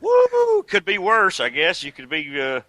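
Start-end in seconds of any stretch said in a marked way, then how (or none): 0.0-0.6 very high, loud signature woo; Ric Flair woo. Retold or imitated. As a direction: let rip the famous whoop. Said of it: "Ric Flair".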